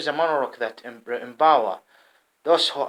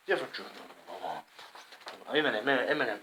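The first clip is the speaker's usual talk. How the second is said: ordinary talk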